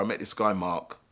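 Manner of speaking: ordinary delivery, nothing marked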